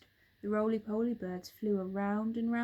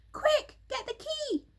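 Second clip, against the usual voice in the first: much higher, urgent monkey voice; urgent monkey call; 5 dB louder than usual. A narrator's reading. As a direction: call up to the roof in a hurry. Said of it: "Mugglewump called out".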